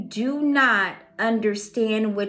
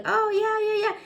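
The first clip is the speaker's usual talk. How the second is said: client's eager agreement; 12 semitones higher, faster than usual. Acted. Client answering the reader.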